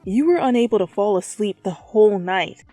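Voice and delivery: normal talking voice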